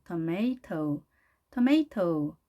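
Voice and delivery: tomato as tuh-MAY-toh with a crisp T; clear T. Shown as a demonstration. Teacher demonstrating tomato in full, tuh-MAY-toh. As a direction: stress MAY in tomato and keep the T. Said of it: "한 다음에 toe 하는 거죠".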